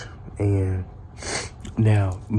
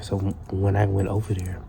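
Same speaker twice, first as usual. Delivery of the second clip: ordinary delivery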